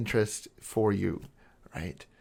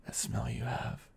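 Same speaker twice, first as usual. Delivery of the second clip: creepy intimate whisper; 7 dB quieter than usual. Acted. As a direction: breathe it right in their ear. Obsessive lover savoring partner's smell.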